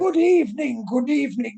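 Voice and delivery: a hint of a flamboyant British host; posh British accent. Shown as a demonstration. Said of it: "bright, blessed sort of voice".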